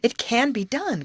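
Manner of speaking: lively storybook exclamation; excited cry. A narrator's reading. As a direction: cry it out with confidence. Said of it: "cried Betty".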